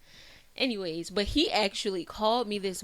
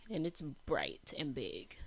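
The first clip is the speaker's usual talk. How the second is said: slow hushed whisper; 5 semitones lower, 7 dB quieter than usual. Real, not acted. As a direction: breathe it out softly. Hushed dreamy about future.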